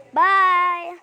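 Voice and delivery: loud cheerful bye; shouted goodbye. Real, not acted. Found, ends video.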